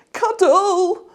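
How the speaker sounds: high, loud demanding shout; child shouting. Acted. Petey shouts at Mum for a cuddle, high loud cry. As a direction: bellow it like a small boy. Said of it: "he shouted".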